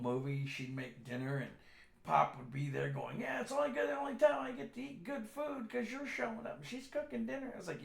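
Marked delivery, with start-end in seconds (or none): 3.2-7.5 high-pitched mock grumbling dad; kidding dad. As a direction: tease in a raised dad voice. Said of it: "he was just kidding".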